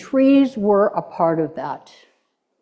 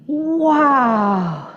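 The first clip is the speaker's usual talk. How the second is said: spoken wow. Shown as a demonstration. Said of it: "Did you guys say that with me?".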